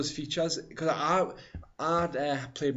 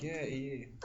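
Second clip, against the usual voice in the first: softer, dry echo of a co-host; dry imitation; 8 dB quieter, slower than usual. Co-host imitating friend's remark on E A, dryly. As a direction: imitate a deadpan sarcastic remark. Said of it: "you sounded very dry there".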